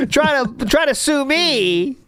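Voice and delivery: high gloating taunt; gloating mockery. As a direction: sneer it out, high and cocky. Mocking Phil's hidden attitude.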